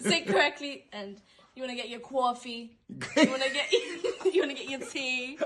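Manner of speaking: put-on New York accent. Interviewer teasing the actor in a laughing New York accent. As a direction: lay on a thick New York accent while giggling. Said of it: "I did not know you could do that accent".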